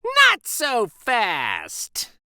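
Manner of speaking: slow, high, menacing witch-teacher hiss; cold warning from a mean new teacher; unpleasant hiss. A narrator's reading. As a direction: stretch it out like a nasty threat. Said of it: "hissed an unpleasant voice".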